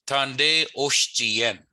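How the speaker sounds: Tande Oshtiyen drawn out very slowly; slow Michif model. Shown as a demonstration. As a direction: spell out Tande Oshtiyen syllable by syllable. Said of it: "the word Tande in Michif means where".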